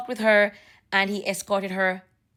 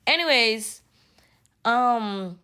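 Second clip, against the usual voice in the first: ordinary talk, nothing marked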